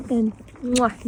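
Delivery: a smacking kiss sound; goodnight kiss. Real, not acted. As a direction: blow a loving kiss. Kissing horses goodnight.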